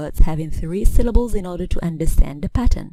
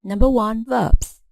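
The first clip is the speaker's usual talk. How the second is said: ordinary talk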